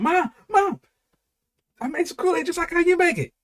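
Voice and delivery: put-on high, eager kid voice, rushing in; excited little boy. A little boy running into his mom's room with his Kool-Aid, high and eager. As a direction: burst in like a proud six-year-old. Child runs to mom.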